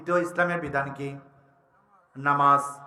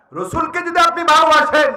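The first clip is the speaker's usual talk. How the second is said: high, loud exhortation; impassioned preaching; 13 semitones higher, 9 dB louder than usual. Real, not acted. Preacher asking listeners to love the Prophet, impassioned. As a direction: lift it high and project to the crowd.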